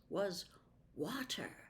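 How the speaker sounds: plain reading voice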